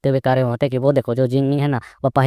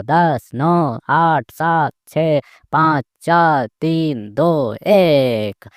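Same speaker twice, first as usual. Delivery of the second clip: slow, higher-pitched count; drawn-out countdown; 3 semitones higher, much slower than usual. Acted. Old woman counting down the final seconds, gloating. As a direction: stretch each number, building to the last.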